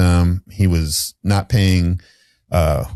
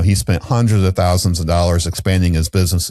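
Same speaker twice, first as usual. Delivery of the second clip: ordinary talk, nothing marked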